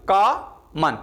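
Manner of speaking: कॉमन split in two beats, "KAA... man"; syllable-by-syllable demo. Shown as a demonstration. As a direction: break कॉमन after the long first syllable, pause, then "man". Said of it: "मेरे आप pronunciation सुने आप".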